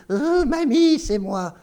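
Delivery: put-on wolf faking a squeaky girl voice; very high, loud, sing-song little girl. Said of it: "j'essaie de voir une voix de petite fille".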